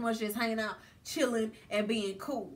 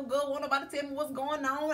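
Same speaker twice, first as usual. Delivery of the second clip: usual speaking voice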